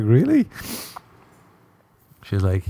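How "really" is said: shocked high rise; disbelieving yelp; incredulous really. Retold or imitated. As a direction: squeak it out in disbelief. Hearing she took Molly.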